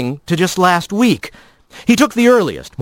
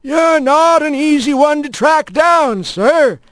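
high, chirpy bird voice; bright little mail sparrow; postbird voice; 11 semitones higher than usual. A narrator's reading. Postbird character voice.

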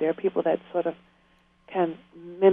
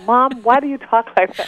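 put-on teasing kids; 6 semitones higher, 5 dB louder than usual. Imitates kids teasing their mom.

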